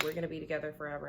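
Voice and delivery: rattled off very fast, mock-dreamy; rushed dreamy quote. Client rattles off her hopes, fast and mock-dreamy. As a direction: gush it out fast like a hopeful client. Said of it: "And it's just like".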